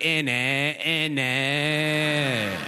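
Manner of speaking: sing-song, stretched British "innit"; mock British accent. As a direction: chant it like a silly British noise. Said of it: "making sounds you like".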